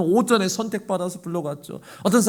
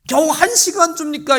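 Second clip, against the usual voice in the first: high, loud indignant question; mock complaint; 9 semitones higher, 5 dB louder than usual. Imagined worker grumbling at the short hour, indignant. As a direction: scoff at the wage. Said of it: "이렇게 불평하는 사람이 아니고".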